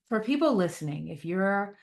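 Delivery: usual speaking voice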